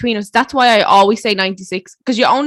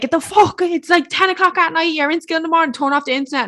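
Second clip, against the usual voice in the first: scolding parent; 7 semitones higher than usual. Retold or imitated. Parent yelling to log off.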